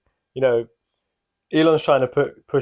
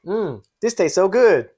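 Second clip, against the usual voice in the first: meme voice; 7 semitones higher, 4 dB louder than usual. Pretend. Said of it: "how we opened with a".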